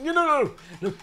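sudden high wail; panicked no. Real, not acted. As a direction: yelp as the grip slips. Climber slips.

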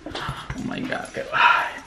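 breathy, unvoiced dismay; worried whisper. Real, not acted. Vlogger worrying about evacuations, worried whisper.

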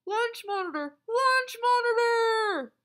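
high, loud shout, second one drawn out; crying out a warning. A narrator's reading. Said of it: "Melvin cried".

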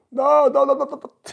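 very high, loud panicked no's; shrill refusal. Retold or imitated. Celebrity refusing the teller's photo, squealing high and loud.

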